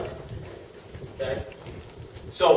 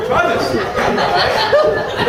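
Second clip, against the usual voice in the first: loud laughing "Try this"; laughing mock pitch; 6 semitones higher, 8 dB louder, much slower than usual. Joking to the audience about selling with one arm, laughing. Mock armless person offering product.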